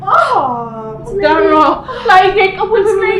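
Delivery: loud, high, tearful panic; frantic crying plea. Acted. Sister crying to God as Laide collapses, frantic. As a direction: beg God for help in a rush of fear.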